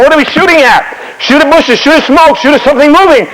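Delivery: high-pitched frantic battlefield back-and-forth; acted panicky troops, voice pitched well up; confused soldiers shouting. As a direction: call out wild orders in confusion. Acts confused soldiers and officers in battle.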